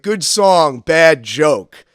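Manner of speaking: loud, blunt verdict from the gambling table; heckler's verdict. Retold or imitated. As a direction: bark it flatly as the heckler. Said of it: "And he's like".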